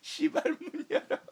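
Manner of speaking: squealed high and fast through laughter; laughing. Retold or imitated. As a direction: rattle it off cracking up. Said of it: "What the fuck?".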